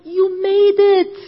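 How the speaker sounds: high, loud triumphant line; joyful praise. Retold or imitated. Jesus welcoming her into heaven, joyful and loud. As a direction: announce it with joy.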